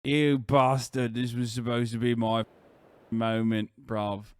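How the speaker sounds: put-on grumbling British bloke, English accent. Streamer reading the ad break to viewers, put-on English accent. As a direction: do a low-key cheeky Brit accent.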